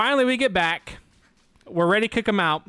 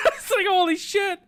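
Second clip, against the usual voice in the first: high, laughing shout; real laughing disbelief; laughing exclamation; 14 semitones higher than usual. Real, not acted. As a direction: cry it out through laughter. Amazed at mom's roast.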